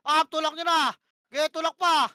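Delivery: loud, urgent push call; yelled orders. Real, not acted. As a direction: shout the team forward. Shotcaller yelling push orders.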